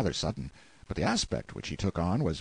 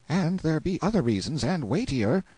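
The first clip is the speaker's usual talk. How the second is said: put-on higher boy's voice, a little reluctant; hesitant; 7 semitones higher than usual. A narrator's reading. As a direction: let it out with some hesitation.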